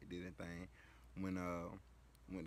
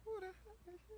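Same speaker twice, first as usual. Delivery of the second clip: relatives' voices; 4 dB louder than usual. Retold or imitated. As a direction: play curious aunties at a cookout. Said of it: "and everybody like".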